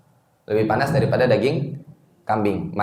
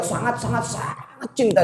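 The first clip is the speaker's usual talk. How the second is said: high, drawn-out SANGAT; "sangat" repeated high, the last one held long; emphatic repetition; 10 semitones higher, 3 dB quieter than usual. Real, not acted. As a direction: build up to a long stretched third "sangat". Hammering how deep the love was.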